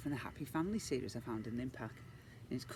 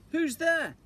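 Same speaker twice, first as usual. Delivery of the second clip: high, loud call from bed; 9 dB louder than usual. Acted. Mrs Jolly calls from bed, high and loud. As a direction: call out to the door.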